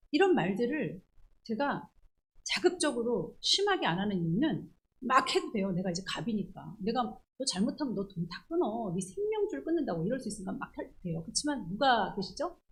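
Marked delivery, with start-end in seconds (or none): none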